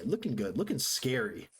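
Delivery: ordinary delivery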